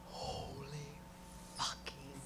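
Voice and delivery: hushed, drawn-out whispered swearing; awed whisper. Retold or imitated. Speaker reliving looking out on the crowd, awed whisper. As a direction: whisper it slowly, word by word. Retelling her awed reaction on stage.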